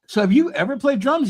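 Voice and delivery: incredulous question. Retold or imitated.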